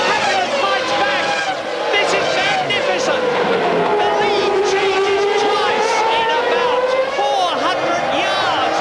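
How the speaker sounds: high, urgent race call; excited commentator. Real, not acted. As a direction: call the overtake at full pitch. Lead change called live.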